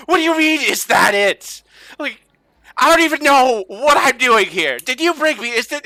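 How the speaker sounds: put-on high, loud, bewildered young man; baffled outsider demanding answers; confused traveler. GM as the lost traveler, voice pitched high and loud.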